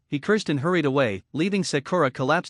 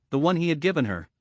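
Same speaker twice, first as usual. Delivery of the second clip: usual speaking voice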